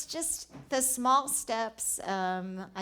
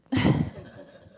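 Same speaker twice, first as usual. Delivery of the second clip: laugh; 6 dB louder than usual. Real, not acted. Laughs at covid joke.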